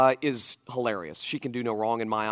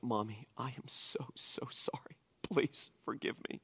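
put-on breathy little-girl whisper begging; pleading whisper; 13 dB quieter than usual. Dad mimics his daughter whispering sorry to mom, pleading whisper. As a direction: beg softly, almost unvoiced. Daughter's fake apology voiced.

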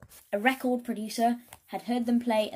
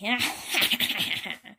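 evil cackle; 3 dB louder than usual. Acted. Queen laughs.

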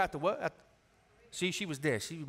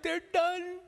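put-on high, thin kid's whimper; mock crying; 8 semitones higher, 4 dB quieter, much slower than usual. Ten-year-old fan mourning his team, imitated crying. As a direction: whimper it like a heartbroken boy.

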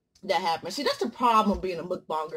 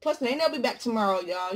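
ordinary talk

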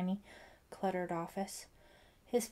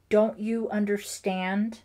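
louder and slow, emotion breaking through; crying out with emotion; 10 dB louder, slower than usual. A narrator's reading. Father pleading with Ellie, loud emotional outburst. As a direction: plead with sudden force. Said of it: "he cried with surprising emotion".

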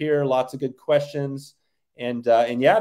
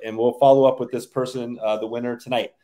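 usual speaking voice

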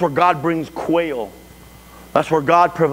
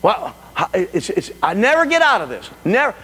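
rising, high-pitched frustration; acted exasperated complaint; 9 semitones higher than usual. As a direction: build to a worked-up cry of protest. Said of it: "conversation that goes on like this".

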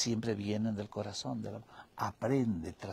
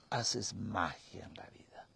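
hushed whisper; 4 semitones lower, 9 dB quieter than usual. Real, not acted. Teacher revealing the secret of love to her listener, whispered.

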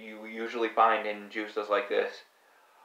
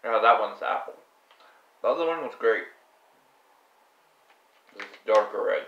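gummy in mouth; talking between bites; chewing while talking; 3 semitones lower, faster than usual. Real, not acted. Seen 0: Gummy put in mouth at -3s; jaw moving while he talks.

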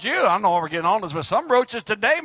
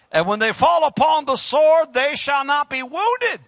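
shouted scripture; 5 semitones higher, 5 dB louder than usual. Acted. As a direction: shout the verse to the church.

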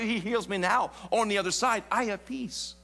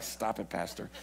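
mock Fran voice; 11 semitones lower, 9 dB quieter than usual. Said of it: "And Fran's like".

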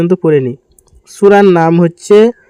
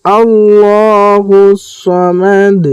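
slow melodic tajweed chant; measured Quranic recitation; 3 semitones higher, much slower than usual. Shown as a demonstration. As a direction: recite slowly, drawing out each vowel.

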